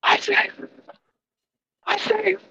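high calling out, fading away; distant desperate cries. Acted. Captain calling back as he flees the mountain. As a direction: cry the name into the distance. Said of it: "you hear him".

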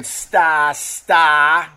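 slow, stretched Boston "Sta Sta"; mock Boston vowels; Boston accent. Shown as a demonstration. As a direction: drop the r's like a Bostonian. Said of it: "That's how they say it in Boston".

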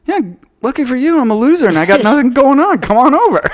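high, quick, over-keen voice; mock eager loser. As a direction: overdo the eagerness to have company. Acts out his eager invitation.

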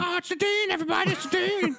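very high, hammed-up old-time announcement; throwback voice. Host playing bystanders spotting the dean, high throwback voice. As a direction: hail the dean in mock alarm. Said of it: "that was my throwback voice".

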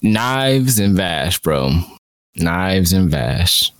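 slow, drawn-out names; savoring names. Real, not acted. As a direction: linger on each name in awe. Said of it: "savoring favorite characters' names".

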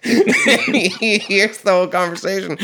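high, loud, talking through laughter; can barely get it out for laughing. Real, not acted. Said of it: "laughing while telling".

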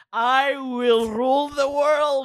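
slow, high, villainous boast; megalomaniac rant. Acted. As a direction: declare it slowly like a villain. Baby boasting about hands.

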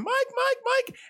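very high, panicked calling of a name; woman calls for her friend in the dark basement, very high; imitated screaming. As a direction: shriek the name in terror, imitating. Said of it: "she didn't sound like that, but ... a lot more girly".

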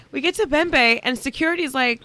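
ordinary talk, nothing marked

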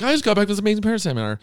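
high, very fast gush; imitating excited lady. Lady at Costco sharing her seminar find, imitated excitement. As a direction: rattle it off like breathless good news.

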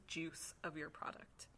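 hushed, slow "juice of your product."; whispered. Shown as a demonstration. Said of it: "I like that he says".

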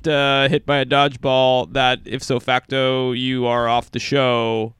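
booming game show host; disguised voice. Acted. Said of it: "this is Jeff Probst with my disguised voice again".

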